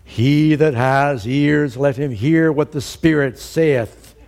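loud, drawn-out proclamation; George Whitefield preaching. Retold or imitated. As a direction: proclaim it slowly to a great crowd. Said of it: "when George Whitefield said".

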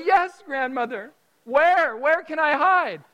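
put-on high, loud, pleading kid voice; eager little boy. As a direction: beg grandma for a place to hide. Eager child begging grandma.

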